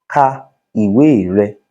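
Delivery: normal talking voice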